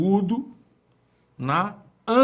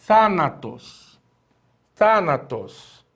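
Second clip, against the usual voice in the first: "thanatos" slowly, THA stretched and strong; stress on first syllable; 3 dB louder, much slower than usual. Shown as a demonstration. Teacher introducing the example word, "thanatos" slowly stressed on THA. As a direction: lean on the first syllable of "thanatos", then let it fall. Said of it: "a primeira sílaba é acentuada".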